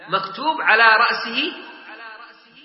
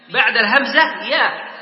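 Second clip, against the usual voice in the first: ordinary talk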